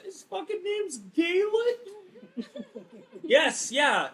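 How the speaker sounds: cracking up in disbelief; high laughing squeal. Real, not acted. Player laughing at the name Galen.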